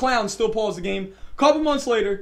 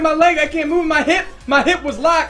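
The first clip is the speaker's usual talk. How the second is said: loud, higher yell of pain; retold scream; 6 semitones higher, 6 dB louder than usual. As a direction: yell it through a cramp. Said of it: "I'm screaming".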